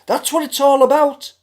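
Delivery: high, loud, heated outburst; angry shout. Real, not acted. Man ranting about red tape for his paperwork, angry shout. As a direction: raise pitch and volume sharply to hammer the point.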